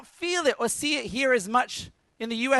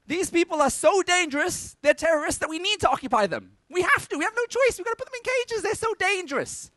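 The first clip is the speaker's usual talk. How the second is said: mocking; 7 semitones higher than usual. Mocking colonial propaganda voice.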